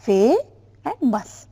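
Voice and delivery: "Fe", pause, then "and mus"; syllable by syllable. Shown as a demonstration. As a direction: separate "Fe" and "mus" as two syllables. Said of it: "what are the two syllables in famous".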